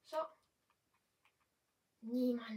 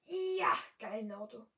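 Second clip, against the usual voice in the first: excited shout; 7 dB louder than usual. Retold or imitated. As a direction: re-enact the screamed "Ja". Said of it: "Wie ich gleich so schrei". (freudig geschrienes "Ja", Rest normal)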